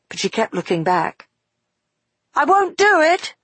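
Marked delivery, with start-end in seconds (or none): 2.3-3.4 high, loud outcry; crying out. Acted. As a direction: cry it out in protest. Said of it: "she cried".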